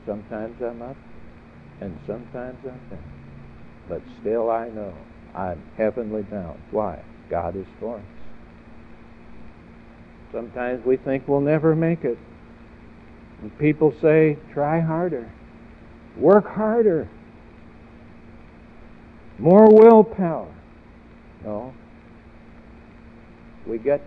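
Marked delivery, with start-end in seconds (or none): 0.1-6.6 softer, more reflective delivery; quiet repeat. Acted. Preacher repeating a saint's saying, quietly. 14.5-20.5 loud, high exhortation building to a shout; mock urging. Preacher mimicking people who demand effort, shouted.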